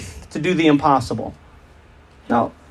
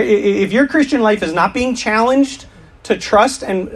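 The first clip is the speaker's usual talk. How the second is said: normal talking voice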